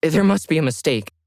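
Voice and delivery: Lauren stunned on the phone, stammering; stunned disbelief. Acted. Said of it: "Lauren was stunned".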